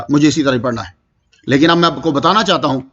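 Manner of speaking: normal talking voice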